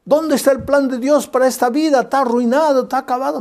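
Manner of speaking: voz más aguda y triunfante del diablo; diablo burlón. Retold or imitated. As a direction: presume de la victoria como un diablo que se burla. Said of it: "el diablo dijo". (el diablo se jacta con voz aguda y burlona)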